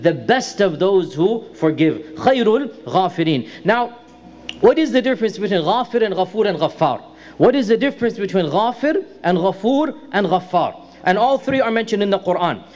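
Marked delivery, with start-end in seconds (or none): 4.6-7.0 fast, run-together delivery of the question; quick question. Real, not acted. Question posed, then repeated for clarity. 7.4-10.8 measured repeated question. Real, not acted. Question repeated, names set apart.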